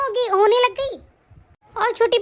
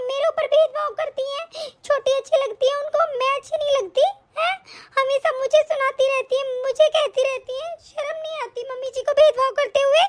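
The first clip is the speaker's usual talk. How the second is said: higher, plaintive complaining daughter-in-law; whining daughter-in-law; 5 semitones higher than usual. Acted. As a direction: play the wronged victim for sympathy.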